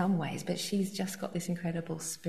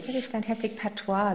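usual speaking voice

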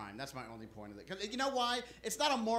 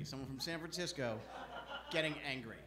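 low, soft repeat of the announcement; hushed repeat; 5 semitones lower, 9 dB quieter than usual. Acted. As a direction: drop your voice to set up the bit. Hushed repeat before the impression.

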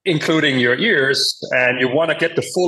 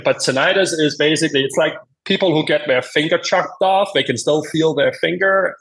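usual speaking voice

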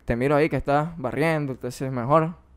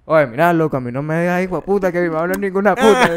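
heated comeback, louder and higher; retold angry reply; angry retort; 3 semitones higher, 4 dB louder than usual. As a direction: snap back like an offended guy.

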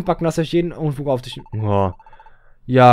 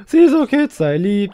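mock scolding; 4 semitones higher, 8 dB louder than usual. Scolds character on the mother's behalf.

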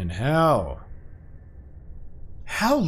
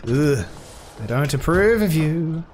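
normal talking voice

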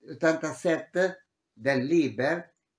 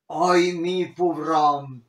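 mock lament; 6 dB louder, slower than usual. Acting a lament.

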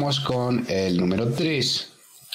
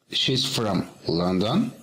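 slow model reading; slower than usual. Shown as a demonstration. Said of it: "model sentence for students".

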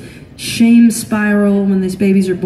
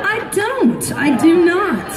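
high-pitched comic denial; indignant protest; 9 semitones higher, slower than usual. Acted. Mom denying her daughter's insult, high indignant protest.

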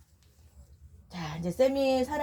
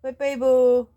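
loud, firm 빠이빠이브 called out as an order; firm command; 3 semitones higher, 9 dB louder than usual. Real, not acted. Mom ordering the dog to do the trick, loud command. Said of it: "빠이빠이브 똑바로 해".

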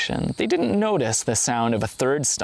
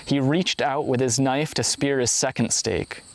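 usual speaking voice

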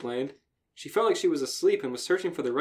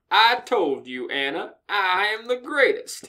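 boast; 7 semitones higher, 4 dB louder than usual. Acted. Reading Mare's boast.

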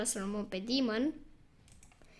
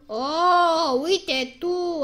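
ordinary talk, nothing marked

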